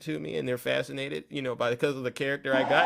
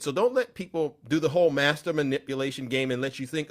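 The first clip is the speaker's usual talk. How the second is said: ordinary talk, nothing marked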